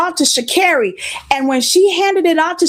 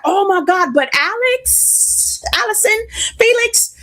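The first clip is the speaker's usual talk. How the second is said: shocked dismay; 6 semitones higher than usual. Retold or imitated. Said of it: "they're like".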